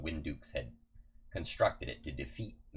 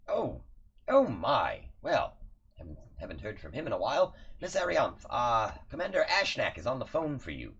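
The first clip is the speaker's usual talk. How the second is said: fussy butler voice; 6 semitones higher, 3 dB louder than usual. Acted. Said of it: "and Reggie says".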